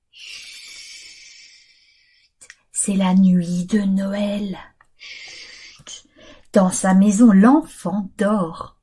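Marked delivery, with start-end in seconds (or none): 0.1-1.3 shushing whisper. Acted. Shushing sleeping child. 5.0-6.1 shushing whisper. Acted. Shushing sleeping child.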